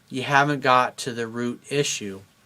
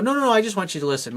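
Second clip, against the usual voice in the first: quick, high, rushed no-no-no; insistent girlfriend; 7 semitones higher, faster than usual. Retold or imitated. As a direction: brush off the advice, fast and high. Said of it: "And she'll go".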